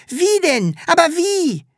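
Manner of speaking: put-on high, pushy kid voices; impatient children. A narrator's reading. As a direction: demand it like eager children. Kids press for details. (drängelnde Kinder, hoch)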